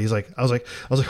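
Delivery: ordinary talk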